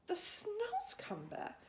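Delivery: high, slow astonished question; little girl repeating in disbelief; surprised cry. A narrator's reading. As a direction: Sophie echoing the strange word, astonished. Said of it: "cried Sophie".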